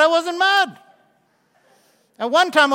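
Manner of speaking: ordinary talk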